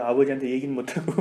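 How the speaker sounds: ordinary talk, nothing marked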